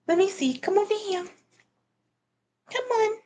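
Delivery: high, coaxing pet voice; high-pitched pet coaxing; pet talk. Real, not acted. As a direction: call a pet over in a high voice. Calling the pet over.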